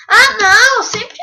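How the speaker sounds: loud, high cry of frustration; frustrated shout. Real, not acted. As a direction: yell it out in dismay. In-game setback, shouts.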